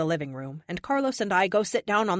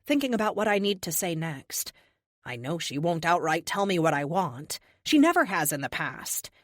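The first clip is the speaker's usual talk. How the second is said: ordinary delivery, nothing marked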